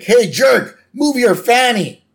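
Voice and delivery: high-pitched impatient holler; Homer yelling. Acted. Homer to the driver blocking him, impatient yell. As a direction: call out angrily to the car ahead. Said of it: "Homer's like".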